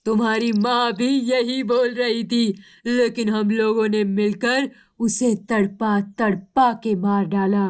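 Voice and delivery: gloating villain eagle voice; cruel eagle. Acted. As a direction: boast about the killing with menace. Eagle gloats over killing. (क्रूर चील, शेखी बघारती आवाज़ में)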